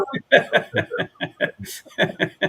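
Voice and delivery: airy unvoiced laugh; breathy laugh. Real, not acted. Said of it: "made me laugh".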